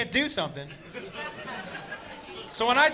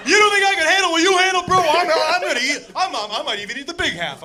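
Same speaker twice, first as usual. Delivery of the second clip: high, loud offended brother; indignant brother; 10 semitones higher, 5 dB louder than usual. Retold or imitated. Brother insisting he'll eat the big half, indignant. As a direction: scoff like he's been insulted.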